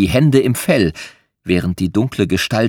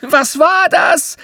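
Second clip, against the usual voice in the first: very high, drawn-out frightened shriek; shrieking in fright; 3 dB louder, slower than usual. A narrator's reading. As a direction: squeal it in panic. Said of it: "kreischte Alfie".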